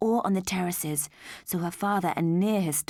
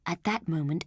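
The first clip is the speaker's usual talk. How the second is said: ordinary delivery, nothing marked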